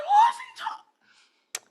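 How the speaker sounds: high, slow laughing disbelief; incredulous laughing cry. Retold or imitated. Telling the podcast about the stewardess, a high laughing cry. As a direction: laugh out the outrage. Retold outraged aside.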